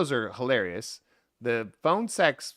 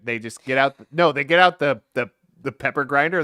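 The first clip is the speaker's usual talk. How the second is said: usual speaking voice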